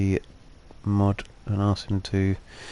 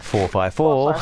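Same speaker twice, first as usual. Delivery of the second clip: football results announcer; 4 semitones higher, much slower than usual. Retold or imitated. Recalling childhood TV football scores, imitating announcer. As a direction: read the score like a telly announcer. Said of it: "And they'd be like".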